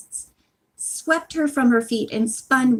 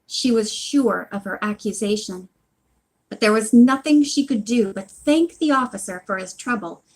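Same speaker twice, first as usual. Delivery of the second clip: ordinary delivery, nothing marked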